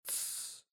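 soft unvoiced shushing hiss; hush. A narrator's reading. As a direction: shush him urgently under the breath. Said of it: "сделал в свою очередь".

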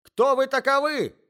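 high voiced lady, demanding; shrill demand from a frightened lady; startled lady. A narrator's reading.

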